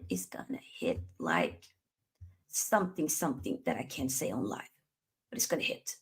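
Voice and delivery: hushed whisper, nearly voiceless. Real, not acted. Streamer whispers to chat what he can't say live.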